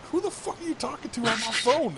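high, loud mock outrage; shrill hammed-up boyfriend rage; angry boyfriend. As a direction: yell it as the fake jealous boyfriend. Friend acts angry boyfriend.

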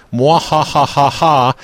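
slow villain cackle; slow evil mwahahaha laugh; mad scientist. Pretend. Gloating reveal of prank.

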